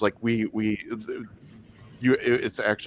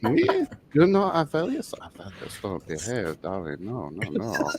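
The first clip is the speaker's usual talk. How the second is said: flamboyant high elf. Pretend. Avelios flirts with the party, coaxing them to misbehave. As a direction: purr at them like a seductive town lord. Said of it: "His name's Avelios".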